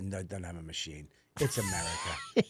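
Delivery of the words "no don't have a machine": much softer, lower mocking whine; mock whiny excuse. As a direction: belittle the excuse in a mumble. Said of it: "Stop with the".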